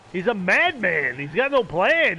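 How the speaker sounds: exasperated complaint. Real, not acted. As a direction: protest at a crazy opponent. Complaining about opponent during game.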